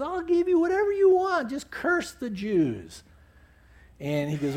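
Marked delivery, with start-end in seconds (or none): 0.0-3.0 high, eager king's voice; pleading king. Retold or imitated. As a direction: coax him like a rich king. King bribing Balaam.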